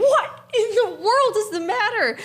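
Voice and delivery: high, exasperated retold demand; alarmed demand. Mom running downstairs to confront her yelling husband, alarmed.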